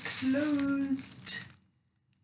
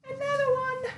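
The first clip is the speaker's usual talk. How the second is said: squeal; 11 semitones higher, faster than usual. Real, not acted. Shoe unboxing, squeals.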